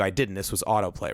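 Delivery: usual speaking voice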